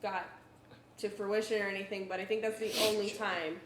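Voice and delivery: usual speaking voice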